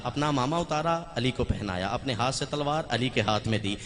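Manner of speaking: ordinary delivery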